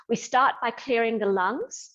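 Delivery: ordinary delivery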